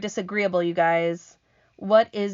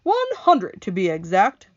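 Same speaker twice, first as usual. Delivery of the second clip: proud centipede; 7 dB louder than usual. Acted. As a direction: show off like a vain centipede. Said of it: "the centipede answered proudly".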